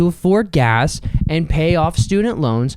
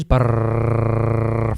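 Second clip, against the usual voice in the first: long rolled-tongue drumroll; low, steady brrr drumroll; imitated drumroll; 6 semitones lower than usual. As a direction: trill a drumroll with the mouth. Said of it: "drumroll please".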